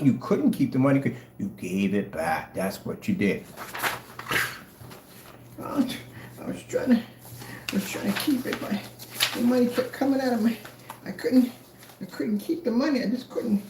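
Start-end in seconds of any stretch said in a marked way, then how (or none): 5.6-13.6 mock whimpering excuse. Mocks seller who couldn't keep money.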